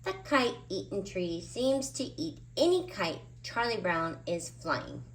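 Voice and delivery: ordinary delivery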